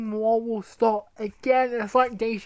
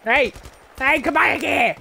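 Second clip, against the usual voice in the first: loud, higher angry shout; angry yelling; 7 semitones higher, 7 dB louder than usual. Acted. Gamer yelling at a fleeing enemy, loud and furious. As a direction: chase him down yelling.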